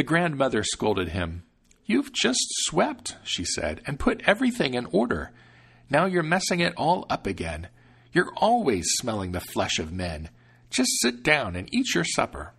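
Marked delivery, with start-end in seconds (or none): 1.8-3.2 put-on high, slow scolding old woman; scolding grandmother. 3.9-12.5 scolding grandmother. Acted.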